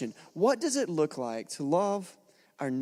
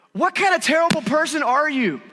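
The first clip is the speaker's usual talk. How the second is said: put-on high, loud, furious demand from an old lady; imitating an old lady's furious opening line; angry old lady; 10 semitones higher, 6 dB louder than usual. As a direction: bark it like an outraged elderly neighbour. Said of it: "this very angry elderly lady".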